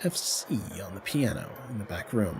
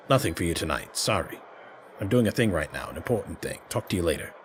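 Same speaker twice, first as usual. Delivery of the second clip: lower, quieter, curt voice of a busy fixer; intense little man; 7 semitones lower, 5 dB quieter, faster than usual. Acted. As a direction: brush them off, no time to spare. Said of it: "He's an intense little man".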